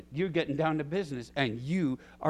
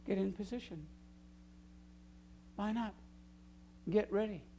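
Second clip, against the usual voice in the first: quiet coaching; 3 semitones higher, 13 dB quieter than usual. Real, not acted. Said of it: "coaching congregation quietly".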